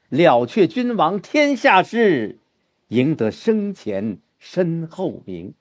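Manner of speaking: high, slow heroic start that sinks on the second line; coming down. Shown as a demonstration. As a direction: start high and drawn out, then let it descend. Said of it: "你看这个里边就下来了".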